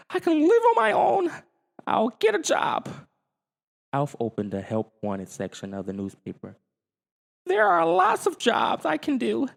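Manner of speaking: very high squeaky alien plotting; Alf's alien voice. Acted. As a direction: scheme aloud in the alien voice.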